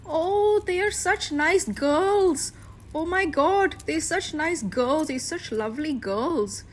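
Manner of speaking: high, gushing Indian auntie accent, mocking. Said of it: "I can't do an Indian accent".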